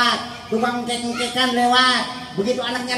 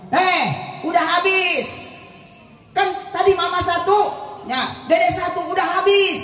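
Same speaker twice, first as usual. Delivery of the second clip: put-on high, emphatic mama telling the child off; scolding mother; 7 semitones higher than usual. Mother to child asking for more fish, scolding. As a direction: voice an exasperated mother, pitched well up.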